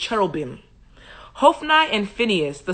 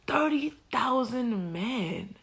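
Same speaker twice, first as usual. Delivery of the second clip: THIRTY THOUSAND high, hushed and drawn out; stunned disbelief; 4 semitones higher, 9 dB quieter, much slower than usual. Real, not acted. Reader taking in the huge Israelite losses, "Thirty thousand" in hushed disbelief. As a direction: repeat "Thirty thousand" slowly, softly, aghast.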